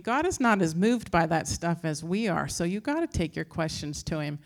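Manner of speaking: ordinary delivery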